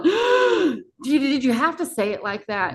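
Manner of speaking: high-pitched retold shock; acted startled squeal settling into protest. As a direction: protest as if floored, voice shooting up. Acts shock at dad's words.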